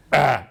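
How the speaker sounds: loud imitated "ah" of dad; dad's dismissive "ah". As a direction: bark out dad's trademark "ah". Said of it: "it was exactly his mannerism".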